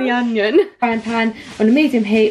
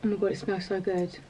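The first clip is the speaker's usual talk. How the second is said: quiet; 4 semitones lower, 12 dB quieter, faster than usual. Real, not acted. Savouring food, muttering.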